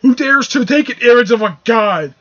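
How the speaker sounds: loud, high, thundering god's proclamation; outraged Thor. Acted. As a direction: bellow it pompously like an offended god. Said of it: "And then Thor's like".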